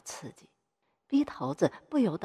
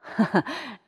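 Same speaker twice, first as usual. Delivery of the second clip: short acted laugh; acted chuckle; 4 semitones higher than usual. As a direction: chuckle briefly in character. Director laughs in greeting.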